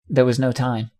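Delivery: ordinary delivery, nothing marked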